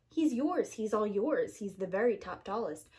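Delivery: usual speaking voice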